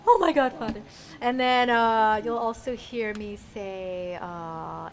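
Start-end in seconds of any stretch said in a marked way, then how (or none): 0.1-0.8 lightly dramatic, amused exclamation; mock lament. Shown as a demonstration. English version of how they say it, mock dramatic. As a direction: exclaim it in the Korean manner, half laughing. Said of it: "This is how they say it".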